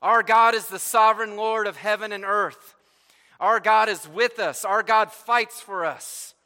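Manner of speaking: loud, high cry of triumph; rejoicing Israelites. Acted. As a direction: proclaim it like a victorious camp celebrating. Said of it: "imagine the rejoicing among the Israelites' camp".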